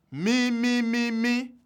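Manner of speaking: mi, mi, mi, mi sung high; high solfa notes. Shown as a demonstration. Teacher sounding four high tones, high. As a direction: pitch each mi well above the speaking voice. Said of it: "high tone can occur in four syllables".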